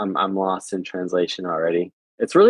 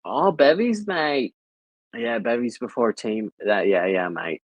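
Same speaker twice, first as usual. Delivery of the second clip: mock Australian accent; 2 semitones higher than usual. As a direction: play the mate-calling Australian, hammed up. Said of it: "I feel like that's more Australian".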